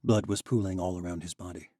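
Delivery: usual speaking voice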